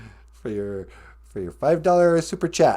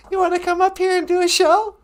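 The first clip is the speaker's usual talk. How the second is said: grandma character voice. Pretend. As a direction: chirp it like a sweet old granny. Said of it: "Donna Bonjour".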